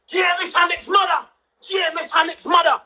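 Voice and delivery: high retold yell up at the block. Nan calling out at the flats, high retold shout. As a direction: holler it like nan outside the flats.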